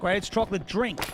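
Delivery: ordinary talk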